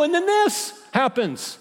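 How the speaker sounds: high, slow, drawn-out whine on this; hammed-up whine, slowed down; whiny complaint. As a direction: drag out the complaint self-pityingly. Second, exaggerated take.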